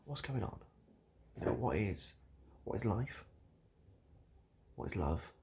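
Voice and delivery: ordinary talk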